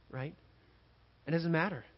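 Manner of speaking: ordinary delivery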